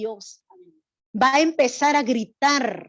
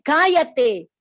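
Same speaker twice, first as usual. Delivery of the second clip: loud, higher scolding of the beggar; Pharisee scolding; 4 semitones higher, 5 dB louder than usual. Shown as a demonstration. Showing the child playing the Pharisee how to hush the beggar, loud scolding. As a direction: shut him up, sharp and rising. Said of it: "Y va a empezar a decir".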